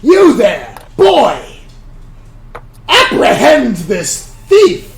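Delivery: loud, high guard's order; commanding shout. Acted. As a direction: bark the arrest order across the crowd.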